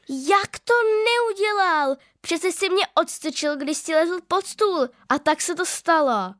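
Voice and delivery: put-on high, slow outraged child's voice; hurt little sister standing up for herself; indignant protest. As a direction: fire back in disbelief. Sister protests brother's denial.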